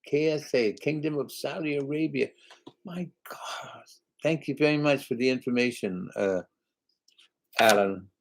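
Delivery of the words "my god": whispered. Real, not acted. Stunned reaction to the Saudi Arabia name.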